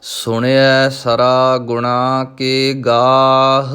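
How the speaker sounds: slow, measured chanted recitation of gurbani; scripture recitation. Acted. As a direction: recite each line slowly and devoutly. (ਹੌਲੀ ਹੌਲੀ ਗੁਰਬਾਣੀ ਦਾ ਪਾਠ)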